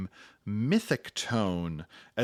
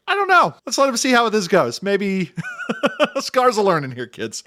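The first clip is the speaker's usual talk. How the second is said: much higher, louder, laughing through it; giggling autumn fae; 10 semitones higher, 8 dB louder than usual. Retold or imitated. Autumn fae watching a baby near an outlet, laughing loudly. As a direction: barely hold back laughter at the baby's mischief.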